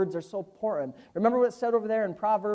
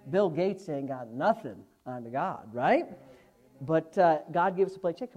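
ordinary delivery

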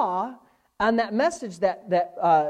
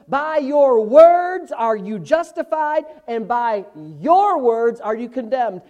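raised preacher's voice, slowing on key phrases; emphatic pulpit delivery; forceful preaching; 5 semitones higher, 7 dB louder than usual. Real, not acted. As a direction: build to a weighty, stretched proclamation.

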